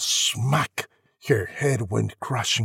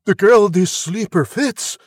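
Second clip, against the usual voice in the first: loud, raised-pitch proclamation; prince's cry; 7 semitones higher, 7 dB louder than usual. A narrator's reading. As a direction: declare it loudly to all. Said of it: "he cried".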